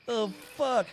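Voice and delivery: high, hushed startled curse; a spooked kid blurting it out under his breath. Retold or imitated. Scared kid caught off guard by the monster, hushed high curse.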